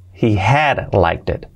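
He will like it drawn out slowly; for learners to copy; slow model. Shown as a demonstration. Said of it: "slow model".

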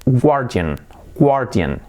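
guardian wrongly as GWAR-tian; pronunciación incorrecta. Shown as a demonstration. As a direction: say "guardian" with a gw and tight ending. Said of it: "primero decía". (guardian mal dicho, 'guar-tian')

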